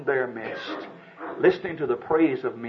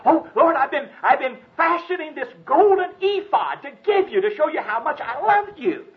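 pleading Gideon; 12 semitones higher, 4 dB louder than usual. Acted. Gideon answers God, acted.

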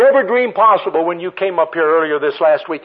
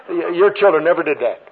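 ordinary talk

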